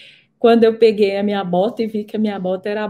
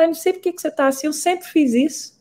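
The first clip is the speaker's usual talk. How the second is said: voicing her mother; 6 semitones higher, 3 dB quieter, faster than usual. Mother voiced in retold story.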